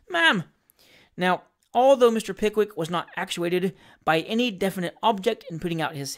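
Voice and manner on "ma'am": high, urgent little cry; desperate appeal. A narrator's reading. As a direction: call to her in desperation. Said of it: "in the extremity of his desperation".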